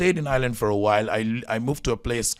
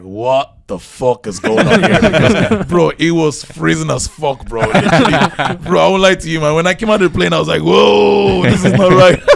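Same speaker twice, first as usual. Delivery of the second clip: laughing loud disbelief; 7 semitones higher, 11 dB louder than usual. Real, not acted. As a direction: crack up telling how cold it was. Laughing about the freezing arrival.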